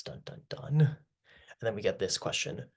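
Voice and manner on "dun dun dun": mock dramatic sting. Ominous sting after dark cloud.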